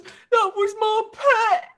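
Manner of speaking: slow, high, tearful ogre wail; mournful ogre. Acted. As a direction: mourn your pet like a hammed-up sad ogre. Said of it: "looks at the goblin and goes".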